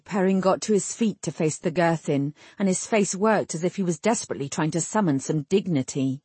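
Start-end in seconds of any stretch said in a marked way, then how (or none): none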